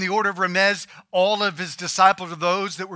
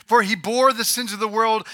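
ordinary delivery

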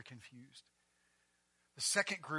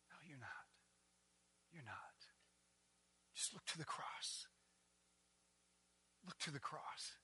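soft reassurance; 11 dB quieter than usual. Acted. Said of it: "tender reassurance".

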